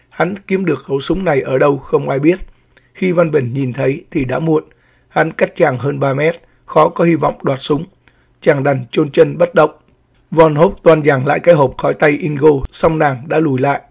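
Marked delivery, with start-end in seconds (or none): none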